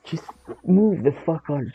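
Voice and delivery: ordinary delivery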